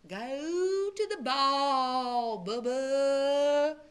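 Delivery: very slow, drawn-out mysterious voice; a voice from nowhere. A narrator's reading. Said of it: "said the voice".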